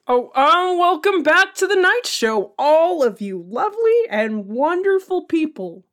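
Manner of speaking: lifted, showy host delivery; show host voice. Acted. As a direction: snap into broadcaster mode after a fumble.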